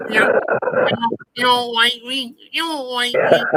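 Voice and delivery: high-pitched mocking impersonation; Bud. [name] mocking a rival's own words, high impression. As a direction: mimic him in a high, silly voice. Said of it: "play it in his own words".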